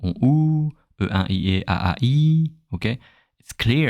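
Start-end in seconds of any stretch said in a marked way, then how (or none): 0.0-2.5 sung-out French vowels, high and loud; French vowels demo. Shown as a demonstration. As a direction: voice "on, ou" and "A A I" clearly and brightly. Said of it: "And the vowel".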